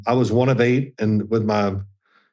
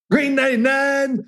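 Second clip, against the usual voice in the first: quarterback calling signals; 12 semitones higher than usual. Retold or imitated. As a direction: imitate your young self calling the play. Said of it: "You sound so funny".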